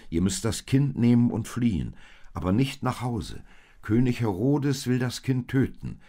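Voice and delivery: loud, high, insistent warning; urgent warning. A narrator's reading. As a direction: press the danger home loudly. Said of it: "mahnte der Engel".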